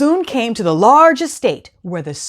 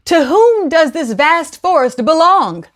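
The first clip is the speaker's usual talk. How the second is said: high, raised regal call; king calling out; 8 semitones higher, 3 dB louder than usual. Acted.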